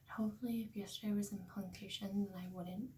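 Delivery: soft early-morning whisper. Real, not acted. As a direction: whisper so you don't wake anyone. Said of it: "I have to be a little quiet".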